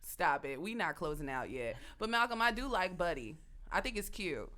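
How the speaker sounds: ordinary talk, nothing marked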